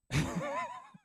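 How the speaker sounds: a high, rueful burst of laughter; high, wry laugh at the ordeal; real laugh. Real, not acted. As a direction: laugh at how long it took. Laughs at three-hour ordeal.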